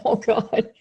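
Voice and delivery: loud, laughing self-scolding; laughing mock guilt. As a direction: laugh through a mock confession. Retelling her own guilty answer.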